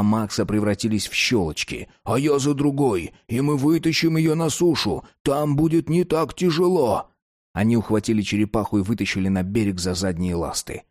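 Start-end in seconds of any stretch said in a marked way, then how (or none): 2.0-7.1 put-on eager boy voice. Max planning the catch.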